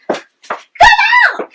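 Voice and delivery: high, loud screaming insult; shouted insult. Acted. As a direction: scream the insult as you leave. (insulte hurlée)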